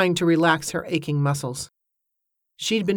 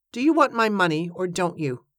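usual speaking voice

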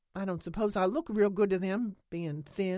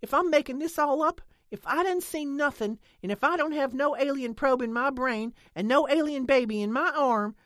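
much louder and higher, building; loud angry challenge; 9 semitones higher, 9 dB louder than usual. A narrator's reading. Lena challenges Nance loudly enough for Holly to hear. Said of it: "her voice was loud enough to carry".

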